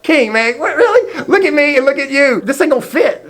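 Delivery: high, disbelieving protest; half-laughing incredulous objection; acted incredulity. David incredulous at armor offer.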